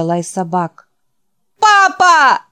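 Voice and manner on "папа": very high, loud cry, voice breaking; cracking scream. Acted. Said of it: "кричал Ричард срывающимся простуженным голосом". (крик срывающимся простуженным голосом)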